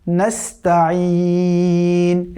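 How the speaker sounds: slow, with a thin সিন and a throaty আইন; নাস্তাঈন with the ain from the throat; correct tajweed. Shown as a demonstration. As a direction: draw out ওয়া ইয়াকা নাস্তাঈন carefully. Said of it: "তো এটা হচ্ছে নাস্তাঈন".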